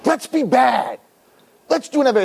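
a light, playful devil voice; tempting Satan. Acted. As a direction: lure them like a sly devil.

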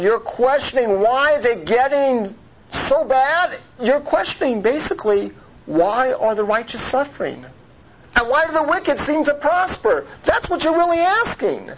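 high, emphatic rhetorical questioning; impassioned preacher's challenge; pointed challenge. Acted. God confronting Moses' question, acted.

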